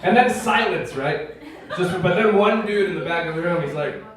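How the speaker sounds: ordinary talk, nothing marked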